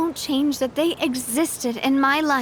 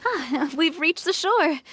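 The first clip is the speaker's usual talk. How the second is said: light laugh; 2 semitones higher, slower than usual. Acted. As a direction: laugh off the sadness as the shore comes into view. Arriving at shore after sad talk.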